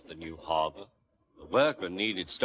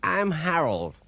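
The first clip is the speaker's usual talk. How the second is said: helicopter voice; 7 semitones higher than usual. A narrator's reading. Helicopter introduces itself.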